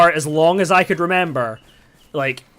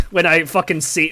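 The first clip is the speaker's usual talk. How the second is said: ordinary talk, nothing marked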